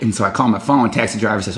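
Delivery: usual speaking voice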